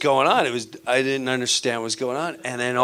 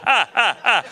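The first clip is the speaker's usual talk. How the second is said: laughing; 7 semitones higher than usual. Real, not acted. Stand-up laughing at own joke.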